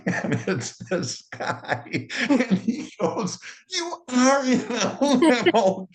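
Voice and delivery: high, slow squeal through real laughter; cracking up. Real, not acted. Teller relives the man spotting the limo, cracking up. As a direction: barely get the words out for laughing.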